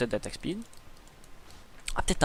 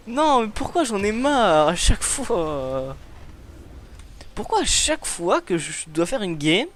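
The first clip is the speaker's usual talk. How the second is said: exasperated rant; 6 semitones higher, slower than usual. Real, not acted. Ranting about lost penta.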